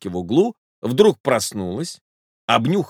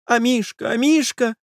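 high-pitched distressed mistress; lady crying out; 13 semitones higher than usual. A narrator's reading. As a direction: wail for the pet in a high lady's voice. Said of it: "закричала дама".

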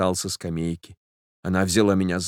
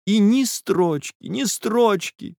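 much higher, slow despairing cry; anguished lament; 11 semitones higher, slower than usual. A narrator's reading. Girl despairing that he never wrote, slow anguished cry. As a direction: draw out each word in anguish.

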